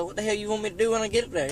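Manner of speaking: fast, high disbelief; exasperated retort. Retold or imitated. Narrator refusing buddy's request, fast high exasperation. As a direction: snap back quick and high. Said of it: "Like, uh".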